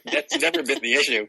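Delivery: laughing through the words. Real, not acted. To the host about the Mayan calendar, laughing. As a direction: chuckle as you finish the line.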